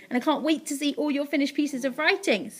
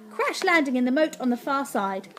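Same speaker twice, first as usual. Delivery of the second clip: plain reading voice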